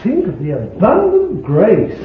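high, punched cries of wonder; exultant preaching. Acted. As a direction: preach it with high, laughing joy.

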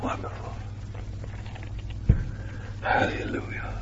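hushed whispered praise; awed whisper. Real, not acted. Preacher whispering praise in awe. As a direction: breathe it in awe.

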